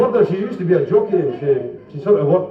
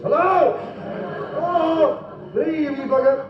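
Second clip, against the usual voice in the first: high, hooting long-distance holler; Ma calling down from a tower block, hammed up; mock megaphone call; 10 semitones higher, 3 dB quieter than usual. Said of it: "with a pair of binoculars and a megaphone".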